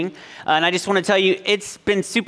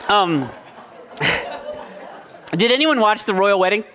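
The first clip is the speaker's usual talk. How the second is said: ordinary delivery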